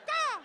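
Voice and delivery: single very high crow squawk; cartoon crow call; bird caw. Imitates bird between chanted drug words.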